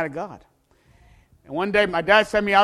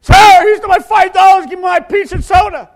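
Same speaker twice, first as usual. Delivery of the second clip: high, loud, slowed shout; boy shouting to the pizza man with found money; breathless triumph; 17 dB louder than usual. Retold or imitated. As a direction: burst in with relief and joy.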